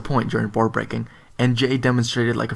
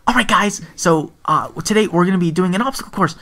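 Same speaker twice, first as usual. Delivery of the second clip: put-on higher-pitched teen instructor addressing class; young sensei; 6 semitones higher than usual. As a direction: imitate a boy leading a class, pitched up. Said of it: "he was like thirteen, fourteen".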